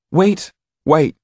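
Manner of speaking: high, loud, urgent plea; pleading boy. A narrator's reading. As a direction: beg them to hold back. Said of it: "pleaded Jan".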